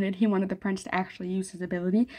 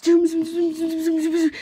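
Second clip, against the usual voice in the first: zooming noise; 8 semitones higher, 4 dB louder than usual. Retold or imitated. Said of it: "I'm over here".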